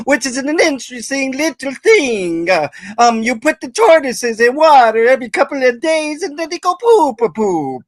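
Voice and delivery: high-pitched, hammed-up Italian accent. Host takes a turn in accent game, hammed-up Italian accent. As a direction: go full cartoon Italian, vowels tacked onto words. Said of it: "me next with the Italian accent".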